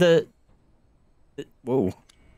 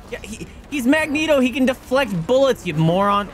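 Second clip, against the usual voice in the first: excited yelling; 13 semitones higher than usual. Real, not acted. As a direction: yell it at the screen. Yelling at teammate in game.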